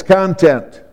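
"content" stressed at the front, CON-tent; the noun "content", CON-tent; stress on first syllable. Shown as a demonstration. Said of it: "put the emphasis on the first syllable".

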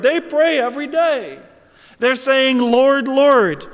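loud, high, emphatic preaching. Real, not acted. Preacher on those who cry Lord, Lord, emphatic preaching. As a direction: hammer the point home at full pulpit force.

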